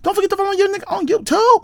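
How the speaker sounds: fast, very high squeal; goofy plug. Pretend. Host plugging YouTube, goofy and squeaky. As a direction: race through it in falsetto.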